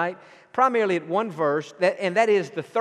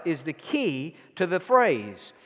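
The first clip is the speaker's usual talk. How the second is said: normal talking voice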